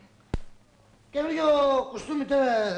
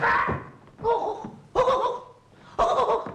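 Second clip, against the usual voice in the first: high, repeated yelps of "ow"; comic howls of pain; cries of pain; 11 semitones higher, faster than usual. Acted. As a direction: yelp in bursts, higher pitched. Cries of pain after sudden mishap.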